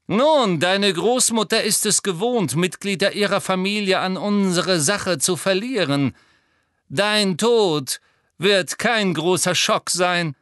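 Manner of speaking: high, loud, lofty taunt; scornful villain voice, lingering at the end; sneering. A narrator's reading. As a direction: needle the boy about his family. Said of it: "höhnte Lucius Malfoy".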